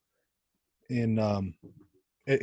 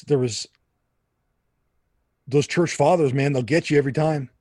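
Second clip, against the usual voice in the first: usual speaking voice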